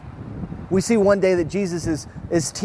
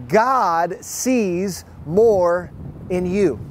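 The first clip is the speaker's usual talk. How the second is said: very slow, each word weighted and set apart; slow emphasis; much slower than usual. Acted. Pastor telling the church God sees more in them, slow and weighty. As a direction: let every word land slowly for the congregation. Opening line said slowly for weight.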